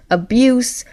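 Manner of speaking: ordinary talk, nothing marked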